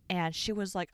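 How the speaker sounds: usual speaking voice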